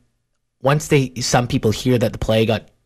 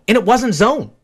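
ordinary talk, nothing marked

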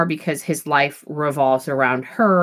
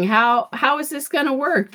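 puzzled; 8 semitones higher than usual. Retold or imitated. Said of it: "I really was thinking".

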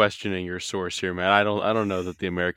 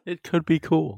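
playful echo; 9 semitones higher, 4 dB louder, slower than usual. Pretend. Echoes it back.

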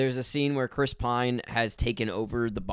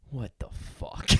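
slow, unvoiced-sounding mutter of disbelief; slow whispered disbelief; under the breath; much slower than usual. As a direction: imitate a friend muttering, stunned. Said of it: "under their breath".

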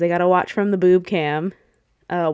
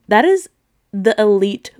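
indignant outburst; 5 dB louder than usual. Real, not acted. Defending burnt marshmallows to viewers, loud and indignant. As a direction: burst out in mock outrage. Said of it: "How dare she?".

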